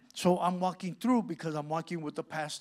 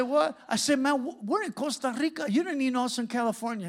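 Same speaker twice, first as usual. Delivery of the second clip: high, raised-voice disbelief; incredulous protest; 8 semitones higher, 5 dB louder than usual. Retold or imitated. Preacher recognized by inmate in Costa Rica, incredulous protest. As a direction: protest as if it makes no sense.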